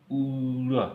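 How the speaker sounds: ऊरा high, with a long held oo; teacher slowly sounding out ऊरा; pronunciation demo. Shown as a demonstration. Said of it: "ऊरा उच्चारण किया जाता है".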